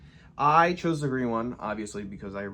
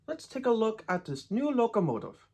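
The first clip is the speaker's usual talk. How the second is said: "let's take a look at this new locomotive" in a hammed-up Canadian accent, pitched much higher; terrible Canadian accent; 9 semitones higher than usual. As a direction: play up the Canadian accent on this line. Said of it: "my terrible Canadian accent".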